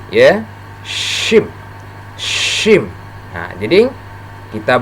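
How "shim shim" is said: SHIM with a long, spread sh; spread-mouth shin. Shown as a demonstration. Teacher demonstrating the letter shin, spread-mouth sh in shim. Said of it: "mulut kita agak".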